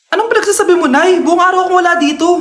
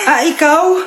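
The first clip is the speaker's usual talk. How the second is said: ordinary talk, nothing marked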